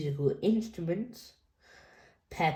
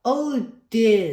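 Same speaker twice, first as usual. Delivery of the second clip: dad voice; 4 semitones higher, 6 dB louder than usual. A narrator's reading. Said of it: "says Daddy".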